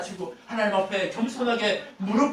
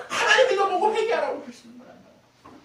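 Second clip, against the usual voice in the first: very high, loud pulpit yell; high-pitched scolding shout; shouted admonition; 9 dB louder than usual. Acted. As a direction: yell it as an urgent rebuke. Preacher shouts at an imagined listener.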